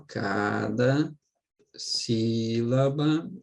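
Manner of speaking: each word stretched slowly for emphasis; teacher drawing out cada sílaba; slow, drawn out. Shown as a demonstration. Teacher to students, stretching cada sílaba slowly. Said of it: "teacher slowing to stress".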